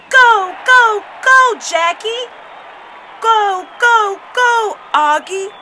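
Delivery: high, loud, stretched-out cheers; screamed cheering. A narrator's reading. Dad cheering the runner on with the crowd, screaming. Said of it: "my dad screamed right along with them".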